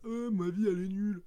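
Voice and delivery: high, loud whine of self-pity; mock whiny lament.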